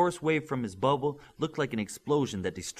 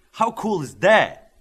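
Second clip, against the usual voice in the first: much louder and higher, slow and savoured; excited; 9 semitones higher, 12 dB louder, slower than usual. A narrator's reading. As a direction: relish every word with delight. Wingman thrilled about Omni teammate.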